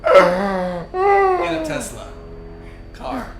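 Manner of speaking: drawn-out mock movie trailer line, high and loud; hamming up a trailer voice, laughing; mock dramatic. Host pitching a silly movie idea, hammed-up trailer voice. Hyping a joke premise.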